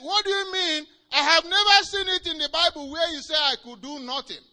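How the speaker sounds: high, loud incredulous shout; shocked protest. Retold or imitated. Hagin protesting to Jesus in disbelief, shouted. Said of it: "And he said".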